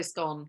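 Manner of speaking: SCONE with a short o, like "gone"; preferred pronunciation. Shown as a demonstration. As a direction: state SCONE to rhyme with "gone". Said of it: "but I say scone".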